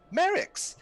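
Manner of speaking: high, loud call of a name; female yell. Acted. Woman calling to Merrick from behind, high yell. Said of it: "a female voice, uh, yell".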